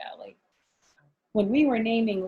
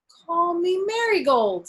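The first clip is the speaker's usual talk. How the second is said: put-on eager child; 10 semitones higher, 6 dB louder, slower than usual. Child eagerly claims flower name.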